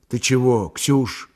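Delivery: usual speaking voice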